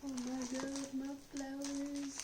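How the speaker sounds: hushed awe at the flowers; delighted surprise. Real, not acted. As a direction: melt over a surprise gift. Opening a surprise gift of flowers.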